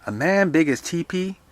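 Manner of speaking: high, loud scoffing question; incredulous caretaker. Acted. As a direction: throw it back loudly in disbelief. Dilsey scoffs at Mother's word.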